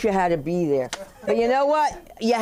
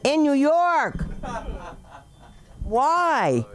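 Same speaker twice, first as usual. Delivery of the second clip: drawn out and pitched up; mock exasperated wail; 4 semitones higher, much slower than usual. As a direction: wail each word slowly in theatrical disbelief. Mock complaint at hecklers.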